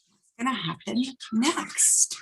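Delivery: hushed, secretive question; suspense whisper. Acted. Teacher whispering a teaser to the children. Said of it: "suspense question to kids".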